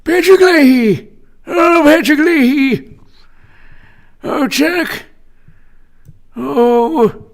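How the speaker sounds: very high, strained senator caricature; Patrick Leahy. Acted. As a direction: squeal out the names as a hammed-up senator. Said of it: "Vermont Senator".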